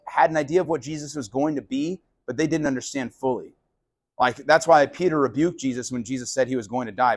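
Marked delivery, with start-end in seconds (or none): none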